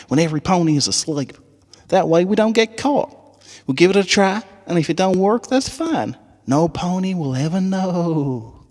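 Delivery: high country filly voice. Acted. Apple Bloom rallying the Crusaders on the school trip, high Southern twang.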